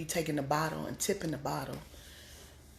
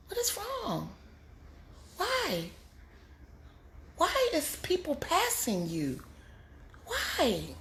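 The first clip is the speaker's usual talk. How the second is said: high pleading questions; exasperated why; 8 semitones higher, 4 dB quieter, slower than usual. Real, not acted. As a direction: plead with them to see it.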